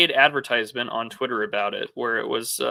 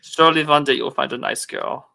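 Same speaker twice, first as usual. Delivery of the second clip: put-on French Canadian accent; 3 semitones higher than usual. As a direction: coo it like a Quebec stripper. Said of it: "I have to do the voice".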